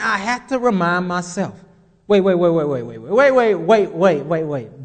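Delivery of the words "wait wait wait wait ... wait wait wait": urgent self-reminder. Retold or imitated. Preacher reminding himself, inner voice.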